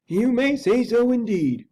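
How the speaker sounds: grave butler agreeing slowly; Poole the butler. Acted. Butler solemnly agrees with the lawyer, high and slow. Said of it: "returned the butler".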